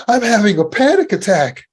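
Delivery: high, loud, alarmed announcement; scared. Shown as a demonstration. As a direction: say it like it terrifies you. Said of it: "that sounds scary too".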